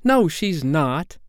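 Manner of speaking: put-on high, slow boyish refusal; childish contradiction, drawn out; stubborn denial. A narrator's reading. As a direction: deny it, stretching each word. Peter refuses the truth.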